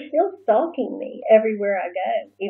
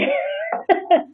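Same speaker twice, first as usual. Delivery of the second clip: high, squealing laugh; a helpless high laugh; high burst of laughter; 11 semitones higher than usual. Real, not acted. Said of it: "it's hard to keep a straight face".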